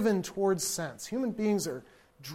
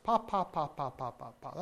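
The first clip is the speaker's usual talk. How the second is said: soft breathy pa pa pa, fading; quiet unvoiced baby babble; 5 semitones lower, 10 dB quieter than usual. Shown as a demonstration. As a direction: turn off the voice early, babble pa. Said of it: "Oh, we could do that unvoiced".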